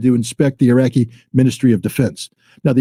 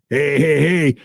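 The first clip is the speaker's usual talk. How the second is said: gloating, pitch climbing higher and higher; mock bragging; 7 semitones higher than usual. US diplomat boasting to French counterpart, high mock brag. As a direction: ham up a smug gloat, "baby" and all. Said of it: "she's bragging".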